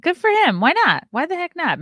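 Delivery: high, loud sarcastic cheer. Real, not acted.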